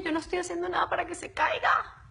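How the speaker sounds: whispered protest of innocence. Real, not acted. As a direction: whisper it defensively, half-joking. Stream drops, she protests innocence. (susurro defensivo medio en broma)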